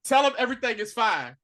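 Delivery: ordinary delivery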